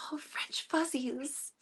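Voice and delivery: slow breathy whisper; imitating the teacher in a hush; whispered teacher quote. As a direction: whisper oh French fuzzies slowly. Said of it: "She'd say".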